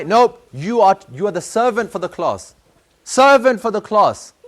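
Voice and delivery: loud, slow stern rebuke; stern teacher. Retold or imitated. Teacher to the boy, loud stern scolding. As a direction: hammer home servant for the class.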